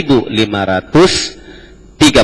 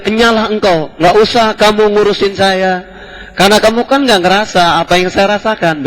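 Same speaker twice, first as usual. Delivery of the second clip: higher-pitched mock rebuff from a grieving woman; memerankan wanita berduka; 9 semitones higher than usual. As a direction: act out her upset brush-off in a raised pitch. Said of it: "karena tadi nangisnya serius". (menirukan wanita berduka mengusir dengan nada tinggi)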